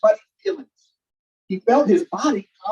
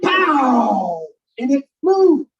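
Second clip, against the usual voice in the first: punchy, louder burst of excitement; excited; 5 semitones higher, 4 dB louder, much slower than usual. A narrator's reading. Reading to kids, excited louder burst as it flies. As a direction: make the launch pop. Exciting moment read aloud.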